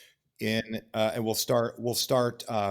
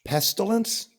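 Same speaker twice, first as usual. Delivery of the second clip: surprised; 8 semitones higher, 3 dB louder than usual. Real, not acted. Surprised echo of answer.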